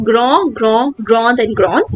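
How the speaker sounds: grand, grand, said slowly as a model for learners; teacher models each grand form slowly; French pronunciation drill. Shown as a demonstration. Teacher reading adjective forms aloud, slow model pronunciation. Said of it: "the first one is begot all".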